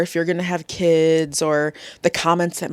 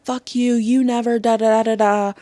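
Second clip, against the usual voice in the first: a quick mock explosive snap, pitched higher; speaking from anger; 5 semitones higher than usual. Shown as a demonstration. Therapist demos lashing out at a family member, mock angry. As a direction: fire it off like a hurt, lashing-out retort. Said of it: "that's speaking from the anger".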